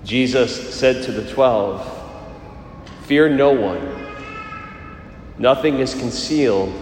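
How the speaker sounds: solemn liturgical proclamation; Gospel reading. Acted. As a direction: proclaim it like scripture at Mass.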